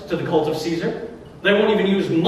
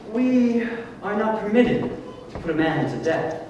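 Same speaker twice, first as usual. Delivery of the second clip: priests' reply; 5 semitones higher, 3 dB quieter than usual. Retold or imitated. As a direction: put on the priests, high and measured. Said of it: "But they replied".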